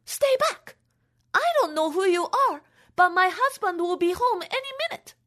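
high, fast, scared warning; frightened wife. Acted. Wife warning off a stranger, high scared voice.

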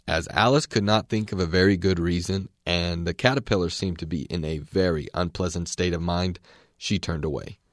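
ordinary delivery, nothing marked

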